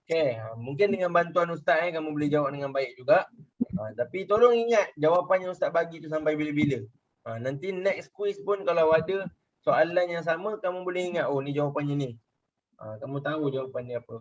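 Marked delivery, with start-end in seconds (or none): none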